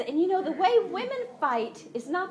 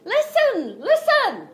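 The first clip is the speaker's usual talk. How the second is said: high, loud call to the crowd; crying out; 9 semitones higher, 7 dB louder, slower than usual. Retold or imitated. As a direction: shout it high from the city wall. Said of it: "this woman cries out".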